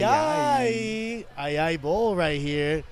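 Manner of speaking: drawn-out, big announcer call of the segment name; playful mock announcer intro; hype segment announcer. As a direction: sell the segment like a show host.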